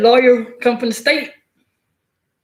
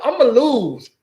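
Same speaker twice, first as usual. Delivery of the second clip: mock lawyer quote; 6 semitones higher, slower than usual. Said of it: "he already tell us straight up".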